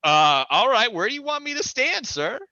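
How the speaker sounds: high, loud, nervous politeness; mock timid and eager to please; nervous compliance. Him placating the armed man, high and nervous. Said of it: "And I'm like".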